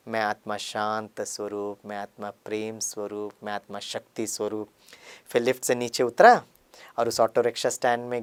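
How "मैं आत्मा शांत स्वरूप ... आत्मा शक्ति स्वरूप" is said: meditative affirmation. Real, not acted. Yoga affirmations before leaving.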